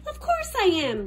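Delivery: booming. A narrator's reading. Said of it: "boomed the giant".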